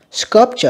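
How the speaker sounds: "Sculpture" high, starting with /s/; S sound. Shown as a demonstration. Teacher contrasting sounds for students, "Sculpture" with /s/. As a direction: open "Sculpture" on an s. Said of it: "âm S ha".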